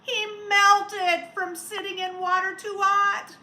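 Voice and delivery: loud, high, dramatic storybook line; loud dramatic read-aloud. A narrator's reading. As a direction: boom it out like the big twist.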